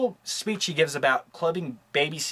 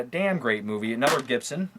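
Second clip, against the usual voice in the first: usual speaking voice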